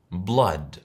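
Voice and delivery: Blood with a short open vowel, /blad/; English pronunciation. Shown as a demonstration. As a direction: say Blood the English way, not as written. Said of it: "Ahora te la digo yo".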